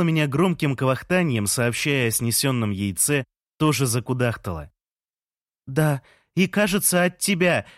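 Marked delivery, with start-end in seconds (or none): 5.7-7.7 high-pitched called reply; shouting back. A narrator's reading. Lea answering Jean on his balcony, shouting back in a high voice. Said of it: "крикнула в ответ Лея".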